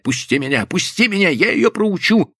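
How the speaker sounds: high, frantic defiance, building from the first plea; struggling cries. A narrator's reading. Wife wrestling out of her husband's grip, angry cries. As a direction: fight to get free, pitch leaping up. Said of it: "продолжала вырываться и кричать".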